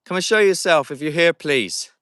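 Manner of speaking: loud, high call into the dark. Real, not acted. Ghost hunter calling loudly to spirits to appear. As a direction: summon whatever is there.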